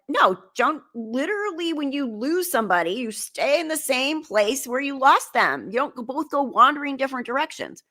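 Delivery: emphatic mock scolding; exasperated lecturing. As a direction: spell it out to a friend in exasperation. Retold quarrel, lecturing her friend.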